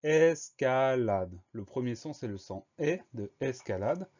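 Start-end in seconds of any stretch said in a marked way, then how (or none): 0.0-1.4 drawn out syllable by syllable; slow dictation. Shown as a demonstration. Teacher gives the next spelling word, slow syllable-by-syllable dictation. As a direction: dictate it very slowly, each syllable held apart.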